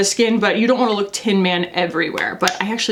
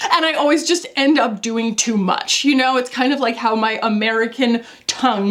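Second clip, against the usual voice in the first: ordinary delivery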